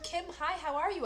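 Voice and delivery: high, bright phone hello; eager greeting. Acted.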